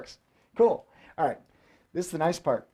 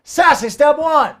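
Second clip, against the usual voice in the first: high, loud, slow sassy call; sassy announcer; 8 semitones higher, 7 dB louder, slower than usual. Pretend. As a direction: strut the words out with sass. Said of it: "The sassy way".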